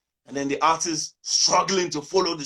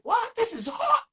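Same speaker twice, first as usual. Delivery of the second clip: far higher, mock outraged squeal; outraged disbelief. He protests in mock outrage that the song is hot. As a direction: play offended that she dislikes it. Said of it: "I was like".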